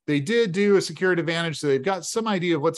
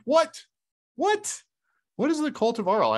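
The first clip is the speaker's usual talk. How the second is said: high, startled double What; alarmed; 11 semitones higher than usual. Acted. Carl hears they can't leave, startled high cry. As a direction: yelp out the shock.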